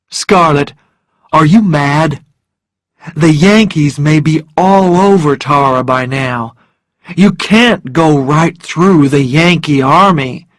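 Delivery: loud, exasperated man; emphatic, disbelieving warning; incredulous Rhett. Acted. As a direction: argue forcefully at your own pitch. Rhett loudly remonstrates with Scarlett.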